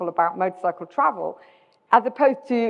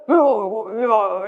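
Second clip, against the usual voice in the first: mock stunned noises from the old local; dumbfounded. Old Alaskan hears the rider is bound for Argentina, imitated dumbfounded. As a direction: imitate someone lost for words. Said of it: "couldn't really take it in".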